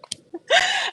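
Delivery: breathy high giggle; high unvoiced giggle; high whispered giggle. Real, not acted. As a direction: let a quick airy laugh slip out. Laughing after aside.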